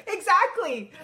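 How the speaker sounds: loud, high retort; re-enacted shout of agreement; retold screaming. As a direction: yell it back at her, retelling the row. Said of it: "So I start screaming at her".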